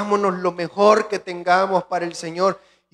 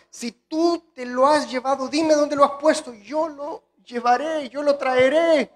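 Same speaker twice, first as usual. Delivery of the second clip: pleading María; 8 semitones higher, 5 dB louder than usual. Acted. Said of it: "le dijo, 'Señor".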